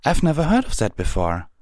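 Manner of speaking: high, quick puzzlement; puzzled Twilight. A narrator's reading. Twilight tells the captain she's puzzled, high and quick. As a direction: pitch it high, never having heard of it.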